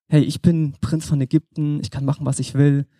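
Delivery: normal talking voice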